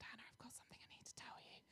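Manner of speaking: hushed confiding whisper; really quiet. Retold or imitated. Friend confides news to the speaker at church, whispering. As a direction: whisper it quickly, like a secret. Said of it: "said to me really quietly".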